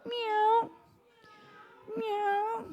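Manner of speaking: high falsetto kitty; falsetto meow. Shown as a demonstration. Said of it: "It's more like falsetto".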